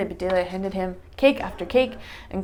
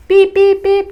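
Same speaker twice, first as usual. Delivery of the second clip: high loud mock timer beeps; 11 semitones higher, 14 dB louder, slower than usual. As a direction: beep it out like an oven timer. Oven timer goes off.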